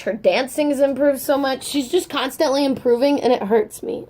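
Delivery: ordinary talk, nothing marked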